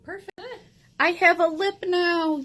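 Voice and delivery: thrilled, high and loud; delighted cheer about the result; excited. Real, not acted.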